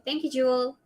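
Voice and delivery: ordinary delivery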